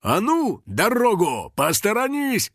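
high, urgent call to clear the way; brusque acted shout; shouted order. A narrator's reading. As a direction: holler through a crowd. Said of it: "закричал Юджин".

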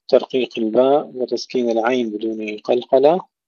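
ordinary talk

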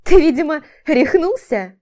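high, loud, incredulous question; rattled and indignant; nervous disbelief. A narrator's reading. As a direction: ask as if he has lost his mind. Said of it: "Нервно хохотнула она".

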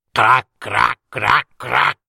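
croaky little frog crac crac; little frog croaking from the dark. Acted. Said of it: "Si sentì gracchiare una ranocchietta".

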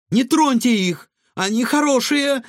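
put-on high, loud girlish plea; shouting. A narrator's reading. Girl protects raccoons from the cook, shouting. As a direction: cry out urgently in their defence. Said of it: "крикнула Илона".

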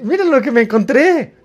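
high-pitched, playful excited wolf. Acted. Hati shows the other wolf a find, excited. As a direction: act out an eager pup showing off a find. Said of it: "Hati está bien emocionado".

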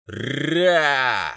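a huge beast's thunderous roar; deep distorted monster roar. Acted. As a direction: let out a booming monster roar. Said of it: "Громоподобный рёв монстра".

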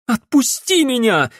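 very high, struggling cry; cried out. A narrator's reading. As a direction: cry out to be released. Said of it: "крикнула Генриетта". (пронзительный крик)

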